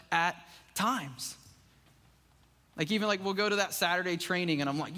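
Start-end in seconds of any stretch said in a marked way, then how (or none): none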